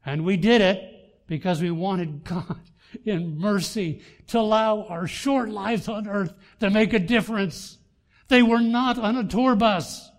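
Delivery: loud, high, emphatic pulpit cry; impassioned preaching. Real, not acted. Preacher rallying the church about the front lines, impassioned.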